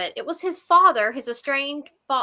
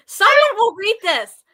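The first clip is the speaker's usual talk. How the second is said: laughing; 8 semitones higher, 7 dB louder than usual. Real, not acted. Laughing at own joke.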